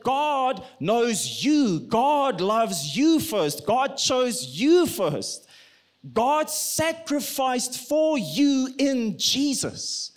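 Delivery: slow, hard stress on GOD and YOU; emphatic preaching. Real, not acted. Preacher telling listeners God chose them first, slow emphatic stress. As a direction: draw out each GOD and YOU.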